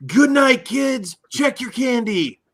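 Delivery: high, loud put-on send-off; gimmick sign-off voice. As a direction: call it out in a high-pitched hammy voice. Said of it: "I don't think I nailed the voice".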